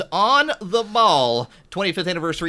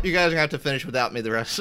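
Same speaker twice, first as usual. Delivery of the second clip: ordinary talk, nothing marked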